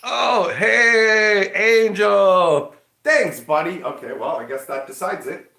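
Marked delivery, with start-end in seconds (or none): 0.0-3.8 high, very loud drawn-out greeting; booming, stretched-out hello; excited shout-out. Real, not acted. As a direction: holler a delighted thanks to a buddy. Thanking a viewer loudly.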